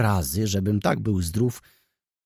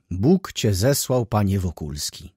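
ordinary delivery, nothing marked

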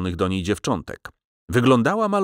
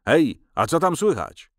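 mildly agitated shout, higher pitched; agitated call; 6 semitones higher than usual. A narrator's reading. Caller at the shuttered window, mildly agitated. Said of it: "Wzburzony głos zawołał".

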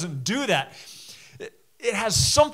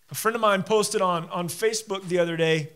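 ordinary talk

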